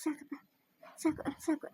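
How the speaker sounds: very soft, drained voice; weak and faint. Acted. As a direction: barely get the words out after fainting.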